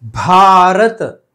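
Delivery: भारत slow and loud, with a clearly aspirated bh: bhaa-rat; correct Bharat. Shown as a demonstration. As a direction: say भारत very slowly, stretching each sound. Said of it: "क्या होना चाहिए?".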